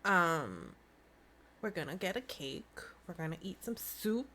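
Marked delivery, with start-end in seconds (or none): 1.6-4.3 soft unvoiced whisper; hushed whisper about soup. Real, not acted. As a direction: breathe it like a secret. Sudden whisper about food.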